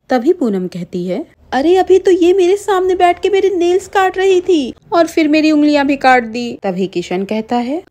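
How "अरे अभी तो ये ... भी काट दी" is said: high, loud, worked-up insistence; agitated protest. Acted. Wife insisting to husband she was hurt, high agitated protest. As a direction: plead desperately to be believed.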